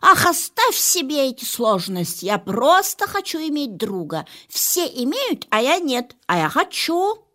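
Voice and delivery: loud, high petulant demand; demanding cat. Acted. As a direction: demand it like a spoiled diva.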